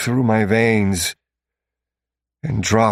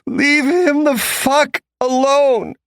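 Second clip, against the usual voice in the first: much higher, loud, slow furious scream; frustrated scream; 6 dB louder, slower than usual. A narrator's reading. Bridget screaming at the captors, furious and high. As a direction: scream it with everything. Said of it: "Bridget's scream of frustration".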